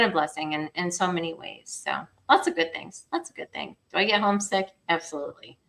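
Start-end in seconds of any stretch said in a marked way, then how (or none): none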